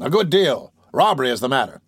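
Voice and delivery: ordinary delivery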